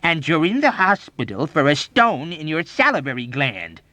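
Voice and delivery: loud, high insistence; emphatic, argumentative; belligerent. A narrator's reading. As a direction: contradict him combatively. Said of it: "contradicted him belligerently".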